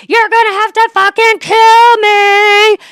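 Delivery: Ruth's furious scream. Retold or imitated. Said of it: "You know that scene where she's like".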